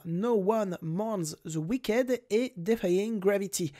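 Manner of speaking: song titles said in a put-on English accent; a light English accent on the English titles. Shown as a demonstration. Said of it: "Avec mon accent anglais".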